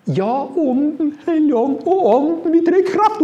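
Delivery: mock charismatic pleading, high and loud; imitating frenzied girls. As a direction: mimic a young woman crying out in rapture.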